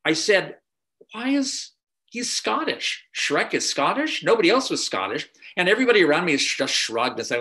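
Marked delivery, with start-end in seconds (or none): 1.1-5.3 high, disbelieving questions; incredulous surprise. Retold or imitated. Writer reacting to first Shrek recordings, incredulous. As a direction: replay your own shock at the Scottish Shrek, much higher. Retelling his shocked reaction.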